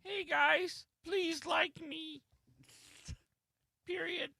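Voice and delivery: very high, tiny pleading voice; mock needy chat user. Co-host mimicking his colleague begging the chat, high feeble mock voice. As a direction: plead weakly, dragging out the words. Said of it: "It's gonna be like".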